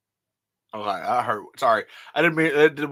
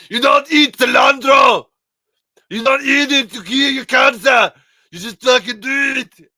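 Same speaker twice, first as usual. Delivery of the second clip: much louder, higher Arnold bark; Schwarzenegger impression; 13 semitones higher, 14 dB louder than usual. Schwarzenegger scolding Stallone on the phone, loud Arnold voice. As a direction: shout at him like Arnold Schwarzenegger. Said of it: "and Schwarzenegger".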